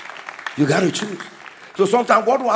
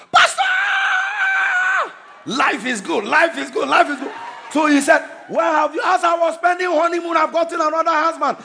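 joyful widow; 10 semitones higher than usual. Retold or imitated. Remarried widow greeting the pastor with joy, higher excited voice. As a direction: burst out with delight at the pastor.

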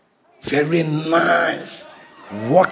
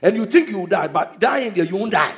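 ordinary delivery

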